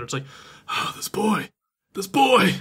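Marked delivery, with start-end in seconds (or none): 0.7-1.5 higher, sighed dad complaint; lightly acted fatherly despair; mock exasperation. Father worn down by his clingy son, exasperated. 1.9-2.6 higher, punched-up dad complaint; mock fatherly exasperation boiling over; exasperated outburst. Father at his wits' end with his son, exasperated.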